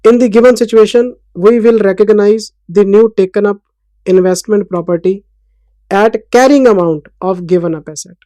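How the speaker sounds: slow, loud, word-by-word note dictation; teacher reading notes aloud for copying. Acted. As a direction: dictate each word slowly so students can write it.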